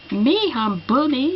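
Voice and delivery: trying out a hammed-up English accent; swooping, put-on English accent in French. Shown as a demonstration. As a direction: test a mock English accent. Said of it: "je sais même pas si je sais faire".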